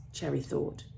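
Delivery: ordinary delivery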